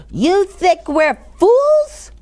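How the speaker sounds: high, very slow indignant challenge; suspicious challenge. A narrator's reading. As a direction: draw it out, daring him. Yenta glaring.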